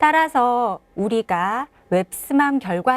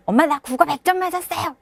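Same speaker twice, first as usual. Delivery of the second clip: put-on proud child; 6 semitones higher, faster than usual.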